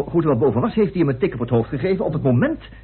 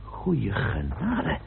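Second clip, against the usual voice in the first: stunned murmur; 6 semitones lower, 8 dB quieter, much slower than usual. Acted. Stunned reaction to the revelation.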